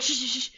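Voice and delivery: quick repeated hushes; imitated shushing. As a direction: shush an over-eager friend. Friend hushing her, retold.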